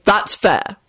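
mildly stern verdict; lightly acted firmness. A narrator's reading. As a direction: settle the squabble firmly. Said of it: "Arthur responded sternly".

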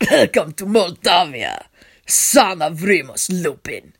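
slow, chilly welcome; cold rough voice. A narrator's reading. Vampire prince greeting his captive, cold and rough. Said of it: "he says in his cold, rough voice".

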